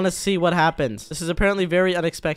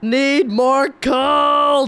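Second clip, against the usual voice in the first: slow shout; 8 semitones higher, 5 dB louder, much slower than usual. Acted. Urging the freezing on.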